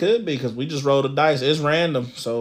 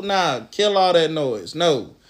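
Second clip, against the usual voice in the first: ordinary talk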